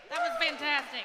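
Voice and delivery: loud, enthusiastic call to the crowd; rally the room with real excitement; excited shout. Real, not acted. Host praising the last act to the crowd, loud and excited.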